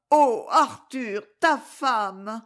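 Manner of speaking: slow, yearning Oh Arthur, then ta femme rising; passionate plea. Acted. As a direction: linger on Arthur, longing. Clémence begs Arthur. (supplique passionnée, lente, ta femme qui monte)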